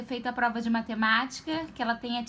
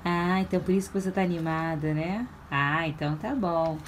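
normal talking voice

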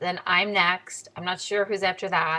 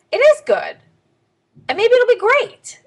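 louder and higher, bursting with excitement; excited; 13 semitones higher, 9 dB louder than usual. Real, not acted. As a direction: hype yourself up about good news. Pumping herself up about news.

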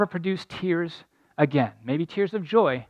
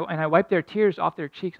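usual speaking voice

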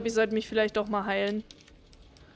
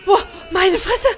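shocked exclamation; 5 semitones higher, 7 dB louder than usual. Real, not acted. After jump scare.

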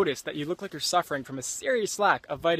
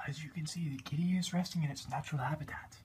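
soft whispered wildlife narrator; nature documentary; 2 semitones lower, 12 dB quieter than usual. Acted. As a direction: hush it like a nature film narrator.

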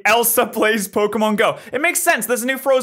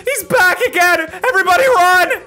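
high, loud panicked warning; mock alarm shout; 12 semitones higher, 5 dB louder than usual. Warning everyone he's back, shouted in mock alarm. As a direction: yell it like sounding the alarm.

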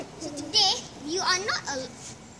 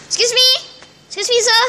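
higher-pitched hail to get attention; calling out; 6 semitones higher than usual. Pretend. Police officer hailing a cyclist, calling out. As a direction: call to someone who isn't listening, pretend police.

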